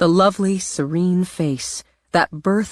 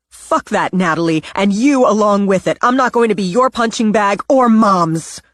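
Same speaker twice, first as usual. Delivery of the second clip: louder and rising, tearing into her sister; angry outburst; 5 semitones higher, 7 dB louder than usual. Acted. Simone unloading on her sister Natalie, loud and furious. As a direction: let the anger climb until it bursts. Simone finally fights back.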